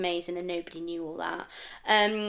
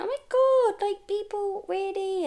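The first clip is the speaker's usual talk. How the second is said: starstruck gush; 12 semitones higher than usual. Retold or imitated. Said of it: "and I'm like".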